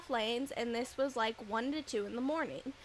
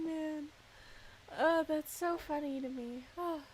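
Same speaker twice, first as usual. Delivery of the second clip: ordinary delivery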